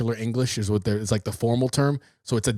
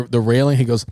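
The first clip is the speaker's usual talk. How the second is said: usual speaking voice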